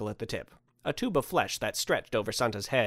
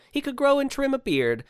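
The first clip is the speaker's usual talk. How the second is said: ordinary delivery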